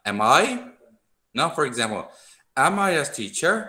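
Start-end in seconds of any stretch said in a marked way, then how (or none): 0.1-0.4 "Am I" with the voice climbing on "I"; rising question intonation. Shown as a demonstration. Teacher shows students the up-and-down question tune, rising at the end. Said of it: "ขึ้นลงนิดนึงนะ". 2.6-3.5 "Am I a teacher" pitched high, lifting at the end; high-pitched model question, rising on "teacher"; question intonation. Shown as a demonstration. Teacher gives students an example question, high rising tune. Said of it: "ขึ้นลงนิดนึงนะ".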